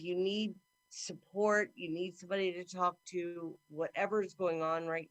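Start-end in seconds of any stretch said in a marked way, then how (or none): none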